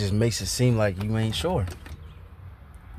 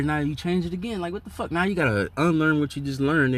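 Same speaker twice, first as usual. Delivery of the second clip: exasperated rant; 6 semitones higher than usual. Real, not acted. Ranting at Bishop Nate for changing doctrine, exasperated. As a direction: pitch jumps higher, incredulous outburst.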